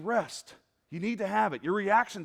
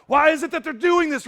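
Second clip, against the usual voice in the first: mock fan outrage; 9 semitones higher, 12 dB louder than usual. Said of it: "We're going".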